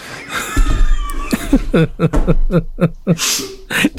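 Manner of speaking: long high-pitched laughing fit; long burst of high laughter; real laughing fit. Real, not acted. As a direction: crack up helplessly, high. Cracking up at the comment.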